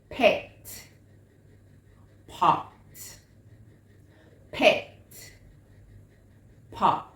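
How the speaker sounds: PET and POT loud, each isolated; PET, then POT, crisply separated as contrasting words; careful listening drill. Shown as a demonstration. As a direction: punch the differing vowels in PET and POT. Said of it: "So listen carefully".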